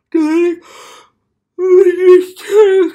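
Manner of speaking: very loud, high-pitched mock wail; mock outraged shout. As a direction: belt it out in hammed-up despair. Yells it, then apologises for it.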